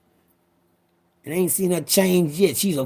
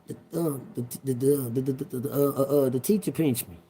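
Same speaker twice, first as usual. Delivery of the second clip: scared stammer; 5 semitones lower, 6 dB quieter, faster than usual. Retold or imitated. Scared child stammering to mama.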